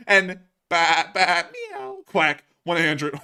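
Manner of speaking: goofy farmyard noises for the viewers; animal noises. Pretend. Host acts out a viewer's animal noises, high silly bleats. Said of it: "me doing the animal noises".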